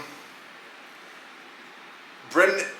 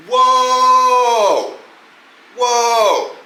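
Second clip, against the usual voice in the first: higher, louder call to stop; loud whoa; 9 semitones higher, 7 dB louder than usual. Acted. Host stops imagined objectors with a loud, higher "Whoa!". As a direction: shout them down, hold it right there.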